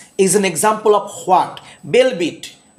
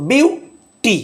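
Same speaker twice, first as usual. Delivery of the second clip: "Beauty" slowly, stress on "বিউ", then falling "টি"; 7 semitones higher, 3 dB louder, much slower than usual. Shown as a demonstration. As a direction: hit "বিউ" in "beauty" and let "টি" fall away. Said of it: "falling action". ("বিউটি"-র "বিউ"-তে জোর, "টি" নামিয়ে)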